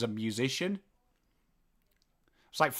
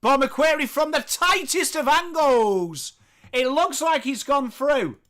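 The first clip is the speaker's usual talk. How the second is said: high-pitched, rising commentary call; excited play-by-play; 10 semitones higher, 5 dB louder than usual. Acted. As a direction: call the shot with mounting excitement.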